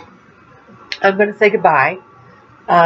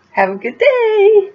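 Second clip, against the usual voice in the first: sing-song goodbye; 13 semitones higher, slower than usual. Real, not acted. Sing-song sign-off to viewers.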